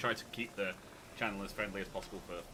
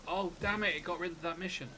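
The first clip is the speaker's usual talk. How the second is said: higher-pitched mock dismay; acted exasperation; 8 semitones higher than usual. Player imagining regret over a lost mission, mock exasperation. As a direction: play a mildly exasperated reaction. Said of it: "and then go".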